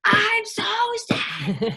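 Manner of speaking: mimicking a kid's high, overblown wail; mock big-emotion cry; exaggerated fake sadness. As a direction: overplay the sad, starting high and dropping. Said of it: "the physical emotion for sad".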